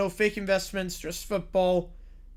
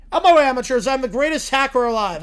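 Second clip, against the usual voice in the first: louder swaggering brag; cocky hacker boast; 3 semitones higher, 9 dB louder than usual. Acted. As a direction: brag to the amateurs.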